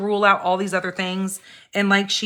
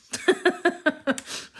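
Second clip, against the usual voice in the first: light high giggle; high laugh; 11 semitones higher than usual. Real, not acted. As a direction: giggle at the silly films. Old horror flicks stupid.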